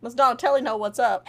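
ordinary talk, nothing marked

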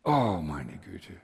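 low, slow sigh of exasperation; weary, sinking groan of resignation; almost resigned. Retold or imitated. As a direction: sigh it out, worn down by a stubborn child. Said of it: "manchmal fast resigniert".

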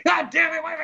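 high-pitched retold outburst; pissed off. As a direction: imitate an actor blowing up.

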